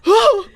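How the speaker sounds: very high, loud cry of shock; hammed-up stricken "oh"; mock shocked gasp. Mimicking the groom's reaction at the altar, shrieked mock shock and sadness. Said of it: "It's like shock, sadness".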